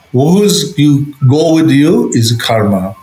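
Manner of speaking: ordinary delivery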